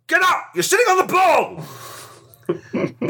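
high, yelled, drawn-out mock outrage; mock shout. Expert acting out golf's biggest rule, mock yelled. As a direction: bellow it like a furious umpire.

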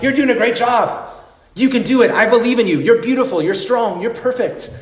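high, gushing fitness cheerleader; Richard Simmons impression. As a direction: cheer them on like an exercise guru. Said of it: "He should say something like".

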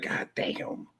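breathless whisper under the breath; whispered god damn. Retold or imitated. Reacting to the boast near the rival's friend, whispered. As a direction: whisper it so nobody nearby hears. Shocked at disrespect in the room.

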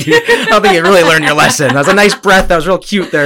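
loud, high, laughing tease; laughing mock taunt. Teller imagines taunting the man about his breath, laughing loudly. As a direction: crack up while teasing sarcastically.

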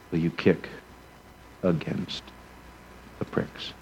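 whispered, breathy drop to nothing; hushed sermon whisper; preacher's whisper. Acted. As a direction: lean in and whisper the end of the line. Dramatic whisper in sermon climax.

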